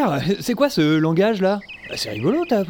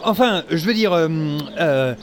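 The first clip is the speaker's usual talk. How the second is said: usual speaking voice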